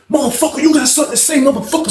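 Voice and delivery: high-pitched retold dare; confrontational challenge. Acted challenge to a fight.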